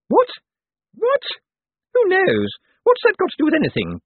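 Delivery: high, loud-edged, incredulous questions; demanding. A narrator's reading. Baffled sister challenging her brother's musing, demanding. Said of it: "Poochie demanded".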